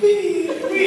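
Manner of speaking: high, giddy squeal of wee with a laugh; laughing high wee-ing; gleeful wee. Retold or imitated. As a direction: squeal it like a kid flying down the slope. Joy of snowboarding in glitter snow.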